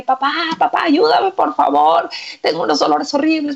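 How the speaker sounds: high, pleading cry for help, hammed-up; acted pain. Injured son calls his father for help, mock agony. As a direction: beg as if hurting, lightly dramatized. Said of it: "empieza con unos dolores terribles".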